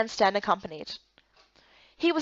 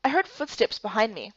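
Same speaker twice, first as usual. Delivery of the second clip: ordinary delivery, nothing marked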